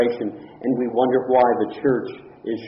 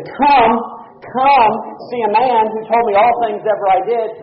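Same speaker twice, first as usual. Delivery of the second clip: eager call; 7 semitones higher, 5 dB louder than usual. Acted. Samaritan woman calls her people.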